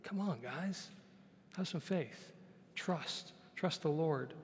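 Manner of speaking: softly voicing Jesus, hushed and gentle; gentle coaxing. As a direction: coax them quietly to have faith. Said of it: "I'm sure he's like".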